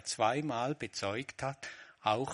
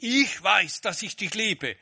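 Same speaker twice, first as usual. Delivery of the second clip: higher and louder, boastful: "Ich weiß"; proud claim; 7 semitones higher, 8 dB louder than usual. Shown as a demonstration. Preacher mimics a boastful Peter, loud proud claim. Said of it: "Er sagt nicht".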